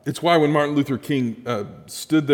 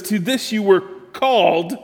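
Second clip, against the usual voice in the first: "to this you were called" raised, landing hard on "called"; emphasis on called; 6 semitones higher than usual. Real, not acted. Preacher reading the key verse, stress on "called". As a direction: lean on "this" and hold out "called". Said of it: "you probably heard me emphasize that word".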